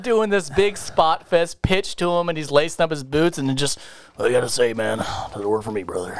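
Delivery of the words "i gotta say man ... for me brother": mild low Hulk Hogan brush-off. Retold or imitated. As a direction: turn him down lazily, wrestler style. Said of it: "he would simply go".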